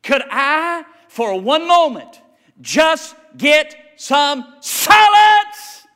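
drawn-out exasperated parent; exasperated plea. Retold or imitated. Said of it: "you know what you want to say?".

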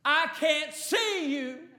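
put-on high, loud child's cry to his father; yelled down. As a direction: call out loudly, drawing out the words. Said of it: "The little boy yelled down".

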